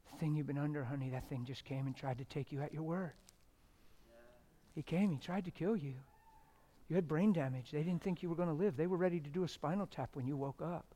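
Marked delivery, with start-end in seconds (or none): none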